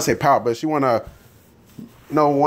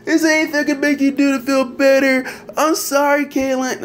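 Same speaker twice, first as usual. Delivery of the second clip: high, syrupy pleading apology; mocking mushy Alyssa; 8 semitones higher than usual. Mocking Alyssa's mushy apology to Caitlyn in a high simper. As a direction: simper it out like a mopey friend. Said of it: "she wanna get all mushy".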